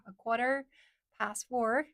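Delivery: American "quarter past four", R's sounded; American accent. Shown as a demonstration. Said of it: "that's American accent".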